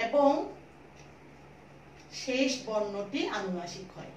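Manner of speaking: slow, with long pauses between phrases; slow dictation. Shown as a demonstration. Teacher dictating a rule for pupils to write, slow dictation.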